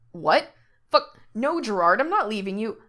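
loud, startled protest; shocked refusal. Acted. Frank protests to Gerard that he won't leave, loud and shocked. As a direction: push back hard, alarmed.

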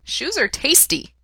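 a simple happy little dog; small dog explaining where it hid. A narrator's reading. Dog Tintin speaks.

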